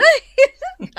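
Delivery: loud high-pitched cackle; high laugh. Acted. Amused by Emang's detailed photo, loud high laugh.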